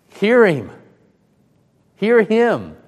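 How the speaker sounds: high, loud call; booming divine command. Acted. God orders the disciples from heaven, booming command. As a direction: proclaim it as God, voice lifted and falling.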